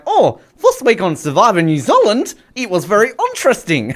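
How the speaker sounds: high-pitched imitation Kiwi; mock New Zealand accent. Host imitating podcast co-hosts, mock Kiwi accent. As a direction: mimic chatty New Zealanders. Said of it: "two other New Zealanders".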